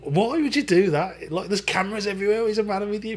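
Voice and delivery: hammed-up scolding of a cheeky mate; retold exasperation. Retelling scolding his mate in McDonald's, high mock exasperation. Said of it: "I went".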